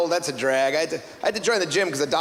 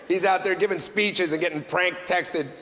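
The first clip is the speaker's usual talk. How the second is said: normal talking voice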